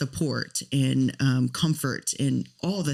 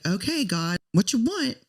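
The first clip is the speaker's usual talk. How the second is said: pitched up high, worn-out and pleading; exasperated plea to God; 8 semitones higher than usual. Retold or imitated. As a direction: throw the question up at God, fed up. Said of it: "And I just was like".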